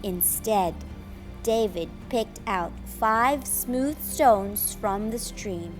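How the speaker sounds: ordinary delivery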